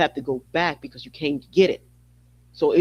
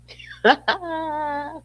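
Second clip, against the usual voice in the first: high giggle; 12 semitones higher than usual. Real, not acted. Laughs after whispering.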